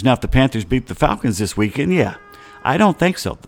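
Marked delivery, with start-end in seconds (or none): none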